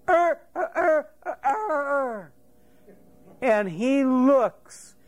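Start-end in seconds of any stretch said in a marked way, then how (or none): 0.1-2.3 high-pitched mock cock-crow; rooster crow imitation. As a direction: crow like a rooster, repeated bursts. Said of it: "he hears".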